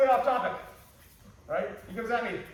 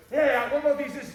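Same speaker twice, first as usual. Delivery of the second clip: mock eager change of subject; 6 semitones higher, 8 dB louder than usual. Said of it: "come out and say".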